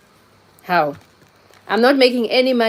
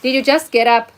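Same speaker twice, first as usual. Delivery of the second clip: higher and louder, teasing surprise; playful greeting; 6 semitones higher, 5 dB louder than usual. Real, not acted. Streamer teases viewer Marcus about waking up, raised and playful. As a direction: tease him for sleeping late.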